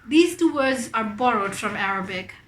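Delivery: usual speaking voice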